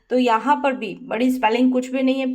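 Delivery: ordinary delivery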